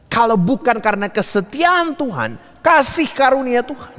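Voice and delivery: high, ringing preacher's exclamation; fervent preaching. Real, not acted. Preacher extolling God's faithfulness and grace, fervent preaching. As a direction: lift it high for the congregation.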